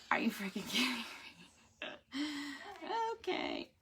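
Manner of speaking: breathless whispered disbelief; stunned whisper. Real, not acted. Wife reacting to the devotion in a stunned whisper. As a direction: whisper it, floored by the verse. Devotion reading hits home.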